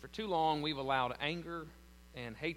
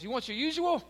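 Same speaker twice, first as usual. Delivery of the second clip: cheerful question; 11 semitones higher, 7 dB louder than usual. Retold or imitated. Owner greets regular.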